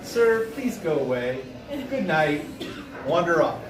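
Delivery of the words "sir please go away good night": polite dismissal. Retold or imitated. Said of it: "I'm like".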